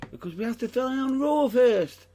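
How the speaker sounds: loud, high-pitched objection; high, loud telling-off; exasperated protest. Real, not acted. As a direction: raise your voice to rein in an eager co-host. Protests co-host skipping ahead.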